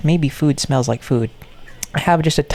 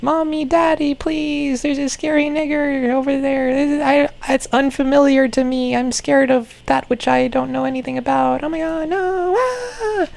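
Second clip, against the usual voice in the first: put-on high, squeaky frightened girl voice; high squeaky mock-terrified doll; squeaky girl toy voice; 11 semitones higher than usual. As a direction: play a shrieking little toy character. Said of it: "then she's like".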